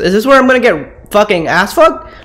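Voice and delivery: usual speaking voice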